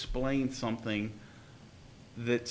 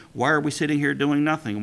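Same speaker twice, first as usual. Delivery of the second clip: louder sudden outburst; bursting out; 8 dB louder than usual. Acted. Brother bursting out at the silent meal, loud outburst. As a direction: blurt it out urgently. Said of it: "suddenly burst forth".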